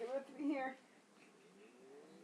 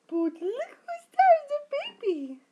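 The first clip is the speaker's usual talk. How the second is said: excited; 7 semitones higher, 11 dB louder than usual. Real, not acted. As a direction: draw it out loud with delight. Excited at baby on screen.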